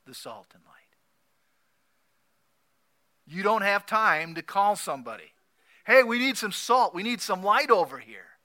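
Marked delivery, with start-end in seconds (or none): 0.1-1.0 whispered. Acted. Preacher drops to a whisper. 5.8-8.4 calling out. Retold or imitated. Calling out for help.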